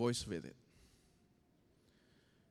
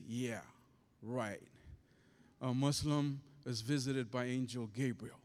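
dry disbelief; 6 semitones lower than usual. Retold or imitated. Retells his own sceptical first reaction.

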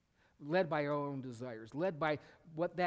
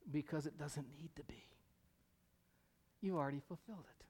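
soft answer; 4 dB quieter than usual. Acted. Answers rhetorical question.

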